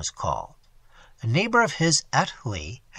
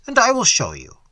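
a touch louder, friendly Welsh countryman beckoning; hearty Welsh host; 8 semitones higher, 6 dB louder than usual. A narrator's reading. Roberts inviting his friend to see the pool, hearty. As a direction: beckon him along cheerfully.

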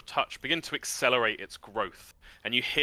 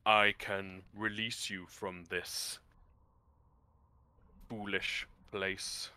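deep rumbling voice; 3 semitones lower, 4 dB quieter than usual. Acted. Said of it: "and just says".

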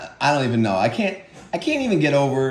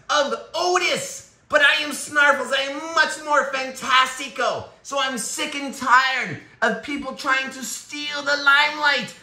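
boastful little creature voice, higher than the owner; voiced pet Snarfles; 9 semitones higher than usual. Pet Snarfles bragging about himself and mocking the tortoise, cocky pet voice. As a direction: brag like a vain pet.

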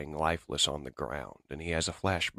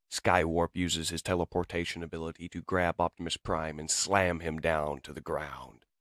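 usual speaking voice

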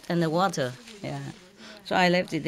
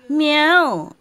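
a single higher imitated meow; cat meow; 6 semitones higher, 4 dB louder than usual. Man retelling how he called the fleeing cat, meowing. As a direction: call her back with a meow. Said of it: "when I say".

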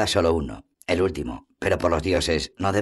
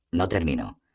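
usual speaking voice

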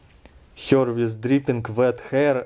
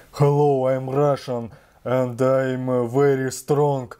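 thick, exaggerated Russian accent; showy, overdone Russian accent; strong Russian accent. Shown as a demonstration. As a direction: lean hard into the Russian accent. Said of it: "show Russian accent, like".

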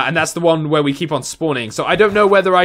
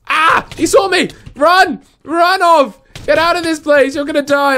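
high, urgent panic as he's spotted; panicked; 9 semitones higher than usual. Real, not acted. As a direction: yelp it out while fleeing. Chased by enemy, panicking.

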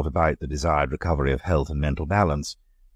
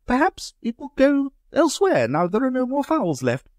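high, light society lady asking; a much higher lady's voice, curious; Amanda's voice; 3 dB louder than usual. Acted. Amanda suggests.

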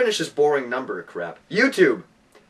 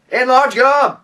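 bright, loud ad shout; excited shout; 7 semitones higher, 6 dB louder, slower than usual. Pretend. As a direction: sell it hard, up high. Mock ad jingle shout.

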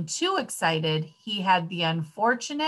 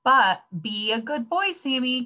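mildly raised, higher motherly plea; mother calling out; 6 semitones higher than usual. A narrator's reading. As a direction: call after a departing child. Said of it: "cried his mother".

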